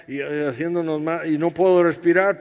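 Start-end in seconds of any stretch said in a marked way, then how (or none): 1.3-2.4 strained, louder gasping complaint as if squeezed tight; mock breathless. Preacher mocking a too-tight girdle, strained and breathless. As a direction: push it out like the girdle is crushing your ribs.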